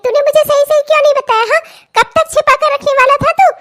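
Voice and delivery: high, fast exasperated telling-off; exasperated rebuke. Acted. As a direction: pelt him with questions, high and fast. Mother rebukes son.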